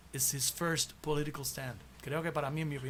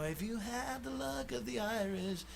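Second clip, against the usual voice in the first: soft, mellow sung line, a little higher; 5 semitones higher, 7 dB quieter than usual. Retold or imitated. Quoting [name]'s somber song, soft and mellow. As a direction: croon it gently and somberly. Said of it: "very mellow".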